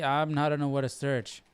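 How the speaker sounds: normal talking voice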